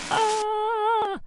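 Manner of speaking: a sudden high yelp as he tumbles; falling cry. Acted. Kangaroo yelps as he takes a tumble, high cry.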